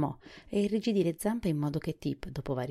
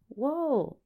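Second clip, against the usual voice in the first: high, loud cry of whoa; shouting; 11 semitones higher, 5 dB louder than usual. A narrator's reading. Tip yells at the bolting horse to stop, shouting. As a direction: call out sharply to halt a runaway horse. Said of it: "gridò Tip tirandosi su".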